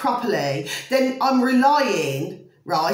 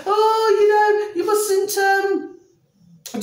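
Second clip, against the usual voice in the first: high, louder mock-prissy scolding voice; mocking the critics; 10 semitones higher, 4 dB louder, much slower than usual. Vlogger mimicking critics who scold her, high mocking whine. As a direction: parody the people telling her off. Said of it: "but they say".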